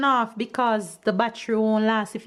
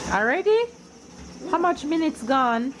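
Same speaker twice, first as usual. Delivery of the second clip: surprised; 7 semitones higher than usual. Real, not acted. Surprised at calories burned.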